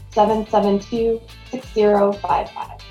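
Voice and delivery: ordinary talk, nothing marked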